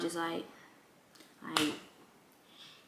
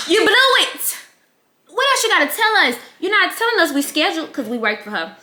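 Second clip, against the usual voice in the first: exasperated; 9 semitones higher, 6 dB louder than usual. Retold or imitated. Mocking the aunt's slip, loud.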